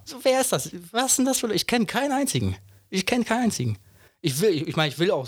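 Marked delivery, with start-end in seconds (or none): 0.0-3.8 much higher pitch, disbelieving questions; incredulous. Real, not acted. Scoffing to his podcast partner, high disbelief. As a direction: ask as if nobody could be that person. Can't believe anyone votes Merkel.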